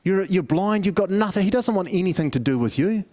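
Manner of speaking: ordinary talk, nothing marked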